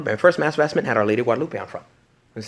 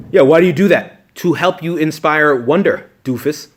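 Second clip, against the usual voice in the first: mock scolding; 4 semitones higher, 9 dB louder, slower than usual.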